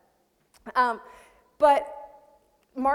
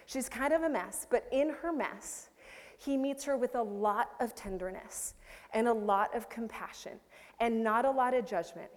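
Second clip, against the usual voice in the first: normal talking voice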